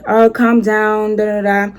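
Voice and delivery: very slow, monotone mock teacher; half-hearted teacher. Teacher feebly settles two quarreling students, droning half-heartedly. Imitating an ineffectual teacher in class.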